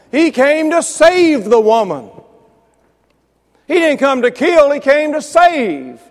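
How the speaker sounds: high, loud, slow emphatic proclaiming; slow, loud pulpit emphasis; preaching at full force. Real, not acted. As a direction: drive it home to the congregation. Preaching climax.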